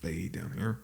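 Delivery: low, very quiet grumble; grumbling dad. Acted. Dad unhappy about house guests, low quiet grumbling. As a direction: grumble it under the breath like an unsociable dad. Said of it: "Your dad just like".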